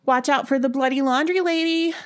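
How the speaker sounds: high, teasing sing-out; joking. A narrator's reading. Ellen teasing Sybil before she walks home, joking. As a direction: call out a playful mock warning. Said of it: "Ellen joked".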